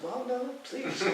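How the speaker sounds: high, slow pleading "no, please"; mock pleading. Him telling the church brothers please go on, in a high, slow mock plea. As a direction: play it as a meek plea that he hopes they'll drop. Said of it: "I'm like".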